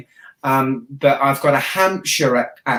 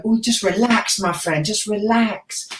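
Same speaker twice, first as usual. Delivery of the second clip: mock soothing; 7 semitones higher than usual. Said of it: "and I think".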